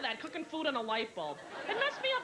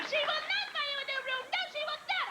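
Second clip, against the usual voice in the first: very high, shrill mock yell; an exasperated mother yelling across the room; sister screaming; faster than usual. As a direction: shriek it way up high. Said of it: "I hear my sister screaming".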